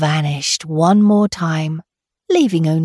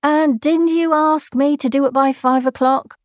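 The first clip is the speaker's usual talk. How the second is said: pointed question; 6 semitones higher than usual. Acted. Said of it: "said Nakwang".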